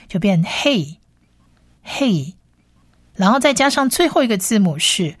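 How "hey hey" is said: hey said slowly, twice with a pause; sounding out hey. Shown as a demonstration. Teacher blends consonant and vowel, slow hey demo. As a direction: model hey as one slow blended syllable. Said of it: "加上下面一串像小葡萄的短母音a".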